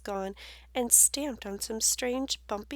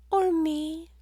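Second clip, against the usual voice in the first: high, louder boast; bragging butterfly; 7 semitones higher, 7 dB louder than usual. Acted. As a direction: show off like a butterfly. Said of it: "bragged the butterfly".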